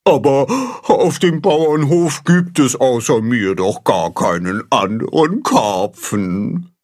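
a touch of mournful whining; whining carp. A narrator's reading. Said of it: "jammert Baldrian".

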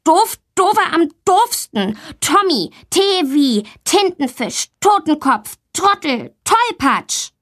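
put-on high-pitched childish name-calling; insulting tirade. A narrator's reading. Valentina defends herself with insults about Tommy, indignant. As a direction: fire off each insult separately, pitched high. Girl hurls list of insults at boy.

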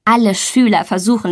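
ordinary delivery, nothing marked

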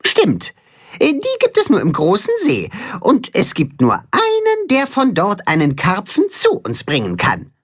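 viel höhere, verschmitzte Hühnerstimme; augenzwinkernd. Acted. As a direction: mit einem Zwinkern den Plan verkünden. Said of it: "zwinkert Tim Max zu". (Timme zwinkert Max zu, hoch und verschmitzt)

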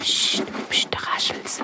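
hushed whisper while hiding. Acted. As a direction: whisper so the mouse won't hear. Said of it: "wir verhalten uns ganz ruhig".

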